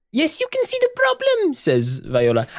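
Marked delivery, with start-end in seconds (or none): 0.1-1.5 very high, squeaky little voice; tiny high wallet voice. Acted. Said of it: "I love how desperate and angry Viola sounds".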